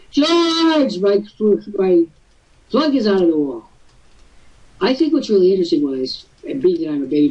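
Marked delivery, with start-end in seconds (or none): 0.1-3.7 high, whiny cry for help over an unplugged cord; mock panicked boomer. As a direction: play a flustered old man calling to George. Baby boomer panicking about technology.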